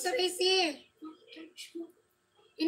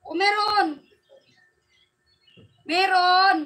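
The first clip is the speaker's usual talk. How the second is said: raised, emphatic call with falling end; loud insistence; 3 semitones higher, 7 dB louder, slower than usual. Real, not acted. Streamer answering viewers who hear nothing, loudly insistent. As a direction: call it out to prove the mic works. Testing mic, insisting it works.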